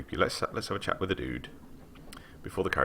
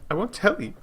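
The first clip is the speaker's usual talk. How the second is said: put-on higher, eager young woman's voice; a young scholar boasting to a traveller, lightly voiced; lightly voiced eager girl; 14 semitones higher than usual. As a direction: read her up high and keen, lightly. Girl's voice again.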